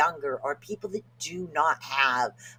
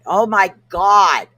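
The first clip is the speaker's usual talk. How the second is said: exasperated; 4 semitones higher, 11 dB louder, slower than usual. Real, not acted. Struggling to pronounce a word.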